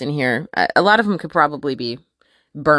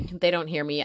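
usual speaking voice

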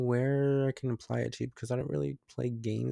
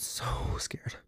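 whisper; 6 dB quieter than usual. Real, not acted. Telling of spider fright.

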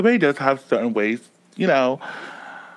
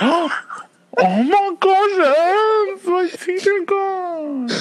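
high, slow toothless wail; mock wail with no teeth; 3 dB louder, much slower than usual. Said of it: "the teeth are crumbled".

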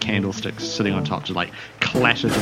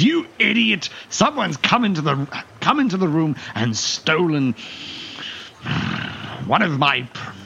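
spluttering anger; 8 semitones higher than usual. Acted. Red-faced captain berating the guard, spluttering high fury. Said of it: "splutteringly angry".

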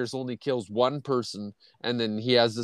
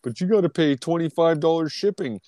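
higher, whiny mock of a cheap seller; a hint of a stingy guy haggling; 6 semitones higher than usual. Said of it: "he's like".

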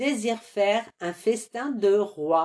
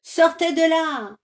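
high, loud commanding shout; ordering; 7 semitones higher, 6 dB louder than usual. Acted. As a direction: order them out fiercely. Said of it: "ordonne Carmelito". (Carmelito ordonne fort aux voleurs de sortir)

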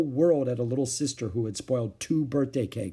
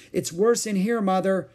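raised, higher-pitched call; 8 semitones higher, 5 dB louder than usual. A narrator's reading. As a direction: call through to Mother. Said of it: "she called".

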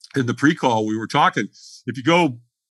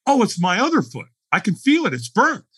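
higher-pitched retold alarm; sudden worried certainty, pitched up; mild panic; 7 semitones higher than usual. Firewalker sure the other foot is burnt, mildly panicked.

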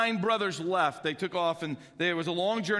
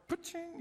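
imitated door slam; 12 semitones higher, 9 dB quieter than usual. Car door slam sound.

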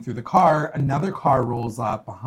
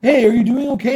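much higher, louder cheerful hey; chirpy stranger voice; 10 semitones higher, 8 dB louder, faster than usual. Retold or imitated. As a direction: play the friendly passer-by, bright and loud. Voicing helpful roadside stranger.

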